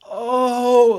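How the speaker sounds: high, loud startled oh; the pilot's sudden dawning panic; alarmed oh. Retold or imitated. Pilot realizes danger.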